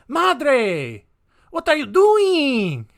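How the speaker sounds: high, loud hammed-up South American accent, slow on the question; mock South American son, loud and high; bad South American accent. The killer as a boy yells at his mother in a hammed-up South American accent. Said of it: "That's gonna be my South American accent".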